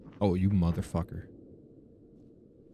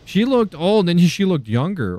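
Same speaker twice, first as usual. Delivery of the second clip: shocked; 7 semitones higher, 7 dB louder than usual. Real, not acted. Shocked by what she saw.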